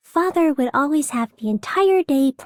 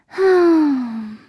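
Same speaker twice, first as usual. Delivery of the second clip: normal talking voice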